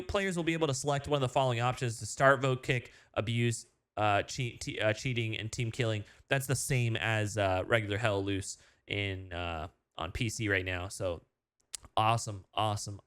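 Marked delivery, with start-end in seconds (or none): none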